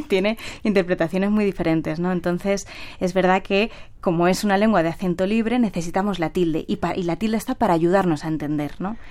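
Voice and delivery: ordinary talk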